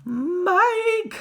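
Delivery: high, loud mother's scream; frantic high-pitched cry. A narrator's reading. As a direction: scream out for your lost child. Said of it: "screamed Missus T V".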